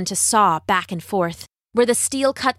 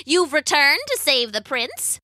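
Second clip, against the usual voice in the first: higher, swooping crone voice; theatrical witch sneer; mocking witch; 5 semitones higher than usual. A narrator's reading. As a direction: taunt her with mock surprise. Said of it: "said the witch".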